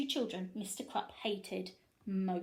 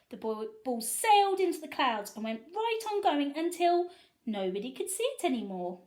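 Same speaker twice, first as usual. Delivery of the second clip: ordinary delivery, nothing marked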